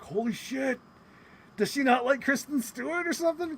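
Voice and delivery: high-pitched retold disbelief; shocked reaction. Reacting to a surprise dig at an actress, mock shocked. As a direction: act out being stunned by possible shade.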